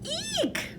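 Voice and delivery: tiny, very high hamster squeak; a sharp, frightened little eek. A narrator's reading. Hamster squeaking in fright at the ghost talk. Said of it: "I squeaked".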